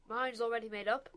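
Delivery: loud curt snap; snapped. A narrator's reading. Father dismissing his wife, loud curt snap. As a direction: cut her off sharply. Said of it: "Mister Elliot snapped".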